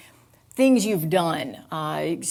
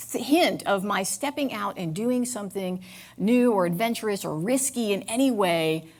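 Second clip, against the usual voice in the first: usual speaking voice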